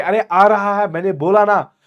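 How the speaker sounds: high, loud snapping reply; acted exasperated yell. Father telling daughter he is coming, acted yelling. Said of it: "चुबुको चीख रहा है कि".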